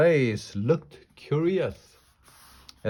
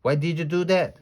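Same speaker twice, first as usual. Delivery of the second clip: higher, angry demanding question; angry holler; 6 semitones higher, 3 dB louder, faster than usual. A narrator's reading. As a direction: holler at them in protest. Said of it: "she hollered".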